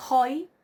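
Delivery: "hoi" with an aspirated h before oi; rough breathing. Shown as a demonstration. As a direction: start "hoi" on a puff of h, one syllable. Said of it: "con el espíritu".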